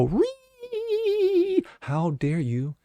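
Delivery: high shrieked "Ree" sliding into breathy outrage; sneering parody of a shocked activist; mocking woke protester. Host mocking imagined critics with a shrill outraged voice. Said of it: "the leftist woke come out and go".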